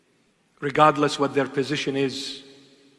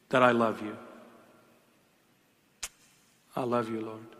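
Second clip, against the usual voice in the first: tender prayer; 6 semitones lower, 8 dB quieter than usual. Real, not acted.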